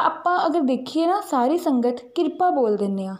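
ordinary delivery

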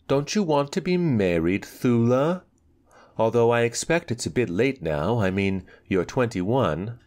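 put-on spoiled girl. Anika voiced, higher girl voice.

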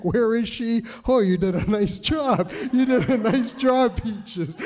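put-on high, excited baby-talk praise; praising the cat. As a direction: coo at the cat like a proud owner. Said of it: "I'd be like".

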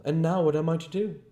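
high, loud exasperation; exasperated outburst. A narrator's reading. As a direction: demand it in loud, high frustration. Voice rants at his exposure.

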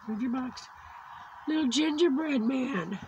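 ordinary talk, nothing marked

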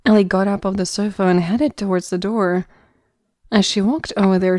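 normal talking voice

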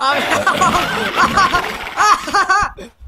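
loud, high burst of laughter; real laughing fit. Real, not acted. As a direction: crack up helplessly. Laughing at the creature.

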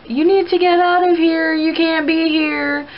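high-pitched imitated yell without real volume; mock yelling. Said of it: "some lady was yelling".

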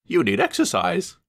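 high, prim coach; Annie's voice. A narrator's reading. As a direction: tease him primly. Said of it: "said Annie".

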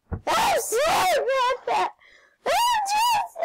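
very high, squealing outburst; squealed apology. Real, not acted. Squealed apology during play.

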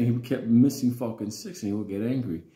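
ordinary talk

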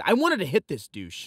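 normal talking voice

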